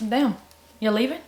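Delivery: ordinary talk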